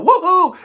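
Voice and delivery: high, loud laughing woohoo; hammed-up catcall whoop; mock leering cheer. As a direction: whoop like a crowd of creeps. Imitating leering guys cheering.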